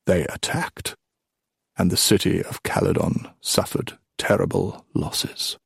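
put-on hushed, grave old storyteller; old king storytelling. Old king telling of Calydon's losses, hushed storytelling. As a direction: let the grim news drop softly.

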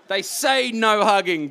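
a chuckling, mock-guilty admission; laughing. Real, not acted. Author to the audience about the hugging ban, laughing.

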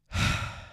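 a heavy, weary sigh; big sigh. Acted. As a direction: breathe out long and tired. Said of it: "lets out a big sort of sigh".

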